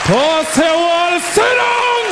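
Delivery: mock Jamaican outrage, rising high; high, drawn-out Jamaican scolding; angry Jamaican passenger. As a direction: order them down in a Jamaican voice, pitch climbing. Jamaican passenger answering the hijacker.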